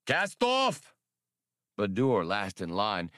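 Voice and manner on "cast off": high, strange creature command; sharp command from the big swimmer. A narrator's reading. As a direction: bark the order in an alien creature voice.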